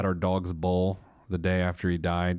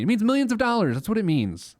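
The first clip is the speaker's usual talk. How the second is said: really getting heated about it; hammering the point home, fired up; heated outburst; 11 semitones higher than usual. Real, not acted. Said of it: "Sorry I'm heated today".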